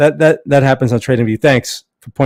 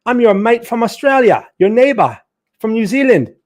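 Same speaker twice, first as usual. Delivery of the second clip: put-on higher-pitched, playful Australian accent; 7 semitones higher, 3 dB louder than usual. Streamer welcomes viewer from New Zealand, mock Australian accent. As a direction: greet them in a hammed-up Aussie voice. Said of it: "I'm gonna have to do my Australian one instead".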